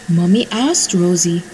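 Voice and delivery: ordinary delivery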